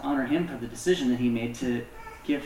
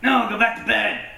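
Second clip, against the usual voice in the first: lightly voiced cranky old priest, higher and louder; grumpy old man; 9 semitones higher, 6 dB louder than usual. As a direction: snap it like an old man woken at night. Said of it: "does his old man grumpy thing".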